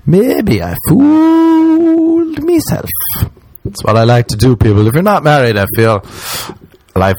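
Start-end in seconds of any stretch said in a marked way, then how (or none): 0.1-2.9 very high, slow squeaked admission; squeaky realisation. Retold or imitated. Man realising he fooled himself, slow high squeak. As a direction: squeak it out slowly, crestfallen.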